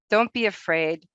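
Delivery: ordinary talk, nothing marked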